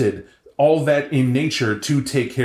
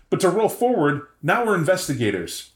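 usual speaking voice

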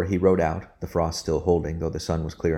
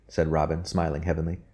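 ordinary talk, nothing marked

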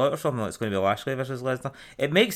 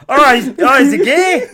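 high, squealing mock quote, laughing through it; much higher, louder, giggling parody voice; mock camp voice; 13 semitones higher, 12 dB louder than usual. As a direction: squeak it out as a camp send-up, cracking up. Mock quote, mocking joke.

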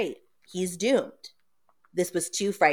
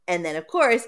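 normal talking voice

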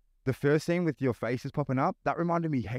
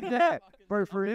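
excited; 10 semitones higher than usual. Real, not acted. Hyped over the show intro.

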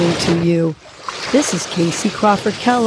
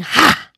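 sharp, higher shouted ha on the exhale; emphatic ha; 7 semitones higher than usual. Shown as a demonstration. Coach leading a power breath, a punchy ha. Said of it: "exhale with an emphatic ha".

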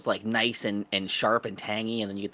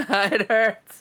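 pained cry; 10 semitones higher, 7 dB louder, much slower than usual. Retold or imitated. Acted pain from sharp cheese.

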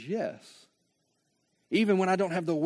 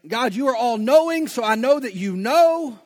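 exasperated plea; 6 semitones higher, 8 dB louder than usual. Pretend.

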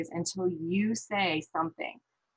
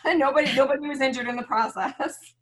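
higher, louder, through laughter; laughing; 6 semitones higher, 8 dB louder than usual. Real, not acted. Mother sums up a tense haircut outing, laughing. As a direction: laugh while getting the words out.

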